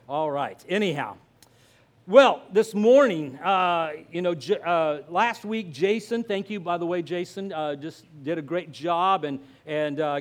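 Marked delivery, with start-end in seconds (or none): none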